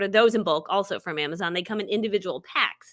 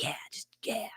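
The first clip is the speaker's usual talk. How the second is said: whispered aside; 13 dB quieter than usual. Retold or imitated. Telling friend get chicken.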